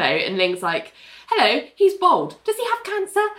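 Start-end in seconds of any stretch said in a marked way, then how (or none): 1.3-3.3 blunt question in a higher voice; really straight. Retold or imitated. Ling meeting the sick boy's mum, really straight. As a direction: ask it bluntly, pitched up, without softening. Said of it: "Like, really straight".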